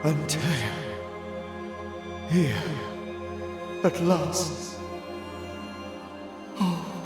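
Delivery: high, grieving ghostly voice; sorrowful ghost. Acted. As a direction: greet an old friend with high-pitched sorrow. Ghost greets friend with sorrow.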